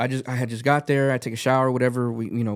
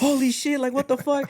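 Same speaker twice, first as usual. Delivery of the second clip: much higher, shocked burst of excitement; stunned disbelief; 13 semitones higher than usual. Retold or imitated. Reading the host's praise in his car, stunned and much higher. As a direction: gasp it out like you can't believe it.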